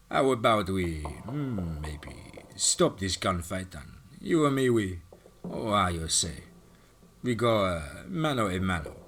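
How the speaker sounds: put-on slow, halting Russian-accented challenge; searching for words in a Russian accent. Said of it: "Kachinko scowled at Hawk and said".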